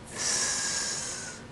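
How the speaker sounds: soft unvoiced hiss; snake hiss. Shown as a demonstration. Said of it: "what's a snake do".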